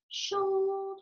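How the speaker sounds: high playful call of shoulders; bright high parentese word; sing-song to baby. Shown as a demonstration. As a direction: pitch it way up for the baby. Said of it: "Give a little squeeze here and say".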